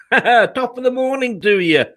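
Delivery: hammed-up Irish lilt, with a laugh; a playful Irish "top of the morning"; jokey Irish brogue; mock Irish greeting. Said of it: "don't know why I've gone Irish".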